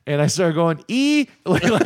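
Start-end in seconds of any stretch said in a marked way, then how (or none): 0.9-1.3 high-pitched mock Kiwi vowel; bad New Zealand accent. Drunkenly copying the waitress, exaggerated New Zealand accent. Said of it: "put on all of a sudden a New Zealand accent".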